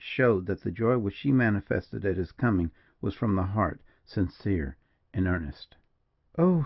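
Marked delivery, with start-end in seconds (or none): 6.4-6.6 mild, raised cry of greeting; understated exclamation; exclaimed cry. A narrator's reading. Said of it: "she cried".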